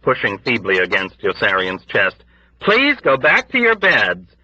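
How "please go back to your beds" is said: high, slow pleading. Acted. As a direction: beg them gently, slowly. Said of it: "Nurse Duckett pleaded".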